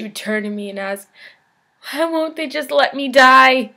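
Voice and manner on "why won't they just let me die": higher, louder anguished question; desperate plea. Acted. Old woman asks the patient why, pleading loudly.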